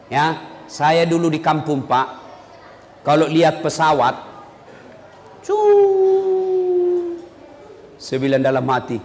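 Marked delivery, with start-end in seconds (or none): 5.5-7.1 plane whoosh. Retold or imitated. Imitating plane noise.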